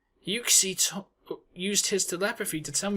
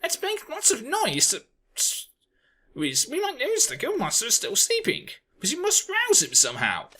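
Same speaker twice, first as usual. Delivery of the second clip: much higher, bossy; high instructing; 9 semitones higher than usual. Acted. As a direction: give orders like a fussy bird. Bird organises a wake-up.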